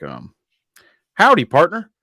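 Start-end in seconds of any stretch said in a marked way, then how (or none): 1.1-1.9 loud, high Texan howdy; hammed-up Southern cowboy greeting; fake cowboy accent. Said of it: "I'd probably say something like".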